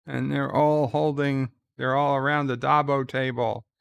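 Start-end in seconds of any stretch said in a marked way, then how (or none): none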